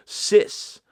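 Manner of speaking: high, loud, sassy "Sis"; sassy slang call-out. Pretend. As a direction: toss it off with sass. Saying slang term with flair.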